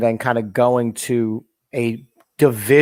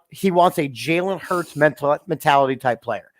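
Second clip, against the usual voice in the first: ordinary delivery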